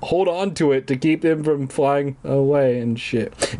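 ordinary delivery

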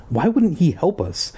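high-pitched, indignant outburst; high angry demand. A narrator's reading. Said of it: "demanded in a high, angry voice".

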